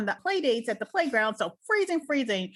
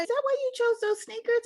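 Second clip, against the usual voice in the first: playful teasing; 13 semitones higher than usual. Retold or imitated. Mom teasing about sneakers.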